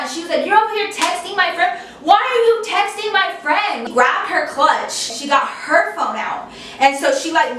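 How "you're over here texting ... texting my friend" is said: high-pitched, outraged accusation; fighting. Retold or imitated. Friend demands why boyfriend texted, acted high-pitched outrage. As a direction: imitate an incensed girlfriend confronting him. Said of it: "they start fighting".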